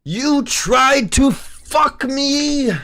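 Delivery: very high, drawn-out mock-triumphant yell; gloating outcry. Trader crowing at the chart that tried to bait him, gloating yell. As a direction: stretch it out high and strained, crowing over a win.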